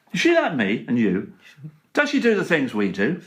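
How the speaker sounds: rhythmic comic verse recital; poem recital. Acted. Comedian reciting his Queen poem, rhythmic and cheeky. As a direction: lay out each rhyme with a wink.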